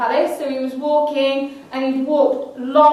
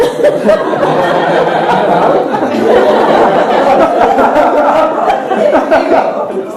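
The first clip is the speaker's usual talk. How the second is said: loud helpless laughter; can't stop laughing; long laughing fit; 4 semitones higher, 12 dB louder than usual. Real, not acted.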